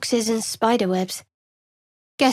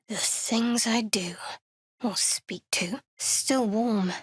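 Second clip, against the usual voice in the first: whisper; 6 dB quieter, slower than usual. Acted. Muttering aside while examining.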